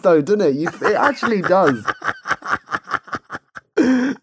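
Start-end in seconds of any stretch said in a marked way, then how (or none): 1.9-3.7 silent breathy high laughter; wheezy laugh. Real, not acted.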